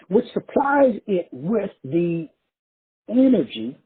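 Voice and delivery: slow, deliberate repeat of the phrase. Shown as a demonstration. Preacher slowing down to repeat his point, slow and deliberate. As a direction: spell it out slowly for the crowd. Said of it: "Let me say it again real slow".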